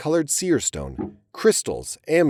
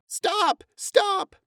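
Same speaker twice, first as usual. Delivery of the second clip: much higher desperate shout; shouting stop. Acted. Lucy Mack shouts at her sons to stop, much higher. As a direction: shout to halt the fight. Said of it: "Lucy Mack shouted".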